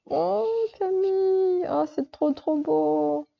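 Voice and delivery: high, drawn-out cooing; real gushing wonder at a friend's gift; touched delight. Real, not acted. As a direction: coo slowly up high in admiration. Delighted by Camille's drawing.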